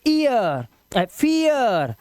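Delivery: "ईयर, फियर" drawn out with ee-ya glide; slow model "ईयर, फियर"; ee-ya sound. Shown as a demonstration. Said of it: "ईए का उच्चारण क्या है".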